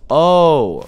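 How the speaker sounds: sudden loud frightened cry; startled shout. Real, not acted. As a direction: yelp at a jump scare. Jump scare.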